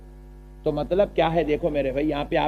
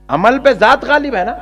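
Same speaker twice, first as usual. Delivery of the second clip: emphatic repeat; 7 semitones higher, 7 dB louder than usual. Shown as a demonstration. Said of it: "عمل پہ ذات غالب ہے نا بھائی".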